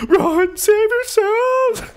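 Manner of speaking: high-pitched, drawn-out panicked yell; mock panic. Gamer warning team under fire, mock panic. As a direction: scream the warning, hammed-up and half laughing.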